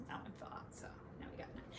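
quick whisper to herself; whispered aside. Real, not acted. She whispers the new count to herself mid-song.

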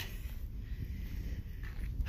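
long heavy sigh out; deep sigh. Real, not acted. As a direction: sigh like you're worn out.

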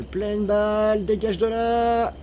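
high, stretched warning holler; long mock-frantic shout; drawn-out yell. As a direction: yell to clear the road. Yells at oncoming truck in game.

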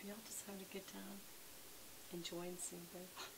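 soft unvoiced whisper to viewers; hushed aside. Real, not acted. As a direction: keep it hushed so she stays calm. Whispering beside calm dog.

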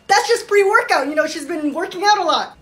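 usual speaking voice

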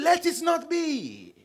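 high, drawn-out mimicked insistence; insistent mock proclamation. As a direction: raise it high and stretch it out like a stern rule. Mimics how others insist on it.

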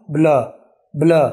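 ब्ला in one glide, bluh; ब्ला, British blur. Shown as a demonstration. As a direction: say ब्ला as one quick syllable. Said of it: "ब्ला नहीं".

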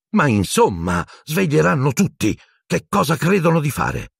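high-pitched scandalised protest; indignant. A narrator's reading. Matron complaining the shouting will wake everyone, indignant. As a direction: fuss crossly, voice climbing high.

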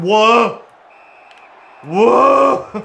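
loud, high "whoa"; startled shouts. Real, not acted. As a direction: yelp it out in alarm. Shock at play in game.

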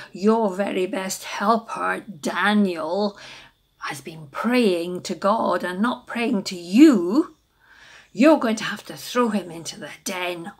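loud, fawning courtiers tattling to the king; butter up the king, then spill the news; flattering helpers. Acted. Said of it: "they said".

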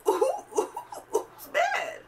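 very high squeaky "who's mad"; mocking squeak. Mocking the angry girl in a very high squeak. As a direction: squeak it out in disbelief.

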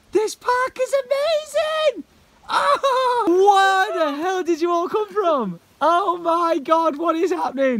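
loud, high, drawn-out exclamation; overwhelmed, stretching the words out high; awestruck excitement. Real, not acted. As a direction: cry out slowly in sheer amazement. Overwhelmed by the park.

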